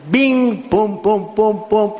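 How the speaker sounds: imitated landing thumps. Imitates wheels touching down.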